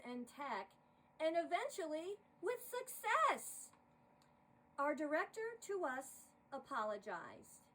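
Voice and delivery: lively rhyming poem read aloud; poem recital. A narrator's reading. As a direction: narrate the verses with bounce and rhythm. Reads a holiday poem aloud.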